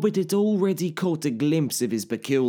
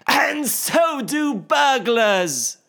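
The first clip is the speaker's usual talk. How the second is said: high, slow teasing parting shot; cheeky taunt; 12 semitones higher, 6 dB louder, much slower than usual. Acted. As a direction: toss it off with cheek.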